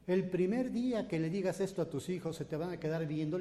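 ordinary talk, nothing marked

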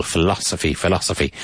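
Philosophy said twice, beat on LOS; stress on second syllable. Shown as a demonstration. Teacher repeating the vocabulary word for students, Philosophy stressed on LOS. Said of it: "Emphasis on the second syllable".

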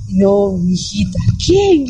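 a slow, lightly voiced old woman; old woman's reply. Old mother answering the journalist in a slow, lightly voiced old woman's voice.

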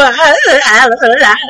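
short, frightened nervous gasps; breathless scared ah-ah-ah; panicked gasps. Retold or imitated. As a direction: gasp in terror as the needle comes. Her panic before the shot.

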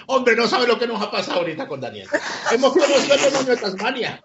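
imita a quien cuenta un chisme muerto de risa; voz aguda y divertida, con risa; entre risas, imitado. Retold or imitated. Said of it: "ha soltado una carcajada". (contándolo a carcajadas, en imitación)

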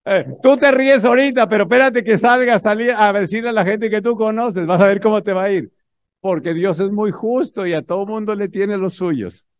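preacher loudly warning the laughing audience; fervent warning. Real, not acted. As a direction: drive it home to the crowd, fired up.

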